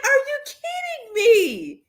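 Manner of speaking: high, slow disbelief; incredulous. Retold or imitated. Reading a troll's comment, incredulous. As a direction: ask it like you cannot believe it.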